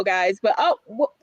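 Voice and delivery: ordinary talk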